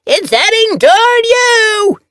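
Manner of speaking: very high, loud, slow yell; excited kitten yell. A narrator's reading. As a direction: shout the warning in a kitten voice. Fuzzball yowls warning.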